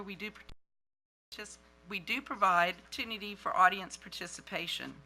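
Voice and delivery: usual speaking voice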